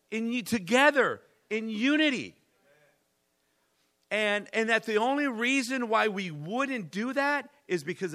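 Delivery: loud, high, fervent preaching to the congregation; rally the church, voice climbing and ringing; preaching at full force. Acted. Pastor rallying his church to unity, loud fervent preaching.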